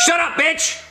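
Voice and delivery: high barked insult; sudden snap. Acted. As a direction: spit it out suddenly. Reads 'avoid talking' as outburst.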